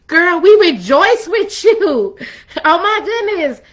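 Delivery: put-on high, slow gushing girlfriend voice; excited girlfriends. Girlfriends cheering her new love, high and gushing. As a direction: gush like thrilled best friends. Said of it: "Her friends like".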